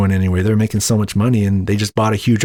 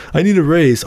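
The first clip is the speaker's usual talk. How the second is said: mock eager request; 6 semitones higher than usual. Acting out raise request.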